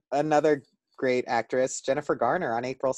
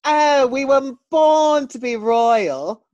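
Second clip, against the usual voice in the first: high, loud and slow royal posh British; posh British accent; 10 semitones higher, 5 dB louder, slower than usual. Pretend. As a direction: lord it like royalty. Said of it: "very proper accent".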